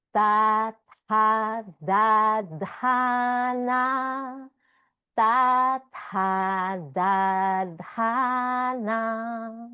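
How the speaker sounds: crisp dental da tha da dha na, tongue tip on the teeth; teacher modelling the dental row for the class; Sanskrit dental syllables. Shown as a demonstration. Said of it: "So this line goes".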